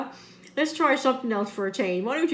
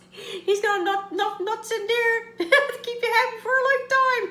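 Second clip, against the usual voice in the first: laughing; 10 semitones higher than usual. Real, not acted. Laughing through the line.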